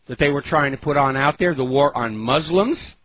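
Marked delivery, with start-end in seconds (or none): none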